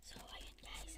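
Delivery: hushed whisper from hiding; whispering while hiding. Real, not acted. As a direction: whisper so you're not found. (pabulong habang nagtatago)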